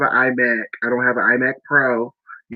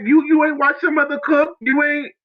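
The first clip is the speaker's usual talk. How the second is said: high mock-angry mother scolding; mad mom; 12 semitones higher than usual. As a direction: imitate an upset mother scolding her kid, lightly. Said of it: "And she was so mad".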